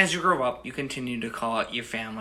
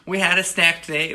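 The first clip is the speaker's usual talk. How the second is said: mock kid excitement; 6 semitones higher, 9 dB louder than usual. Child voice, excited snack report.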